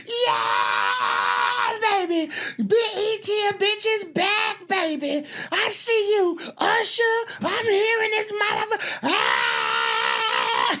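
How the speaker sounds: very high falsetto screech, drawn out; excited screaming. Retold or imitated. Imitating host hailing the BET crowd, screaming with excitement. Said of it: "She's screaming and yelling".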